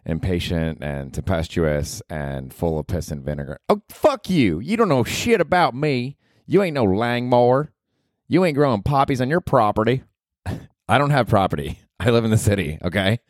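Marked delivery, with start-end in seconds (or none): 3.7-10.0 Ruth from Ozark. Acted. Podcaster voicing Ruth, cursing at him, brash and high. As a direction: snap it like a foul-mouthed hillbilly girl.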